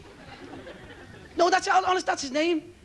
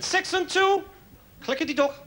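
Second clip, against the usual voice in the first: bingo caller shouting; 5 semitones higher than usual. Retold or imitated. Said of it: "Irish fella shouting the bingo out".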